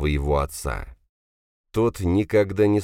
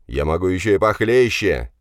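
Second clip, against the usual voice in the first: moderately raised angry voice; shouting higher; 3 semitones higher, 4 dB louder than usual. A narrator's reading. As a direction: push the threat up a little in pitch. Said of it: "на тон выше закричал Драгунов". (прикрикнул чуть выше тоном)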